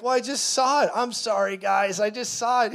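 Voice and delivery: sheepish apology. Retold or imitated. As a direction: squeak out an embarrassed excuse. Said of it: "I was like".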